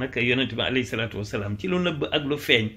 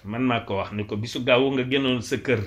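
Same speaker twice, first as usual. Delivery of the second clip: ordinary delivery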